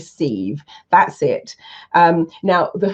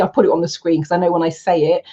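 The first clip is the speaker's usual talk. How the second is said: normal talking voice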